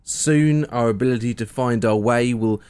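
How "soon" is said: normal talking voice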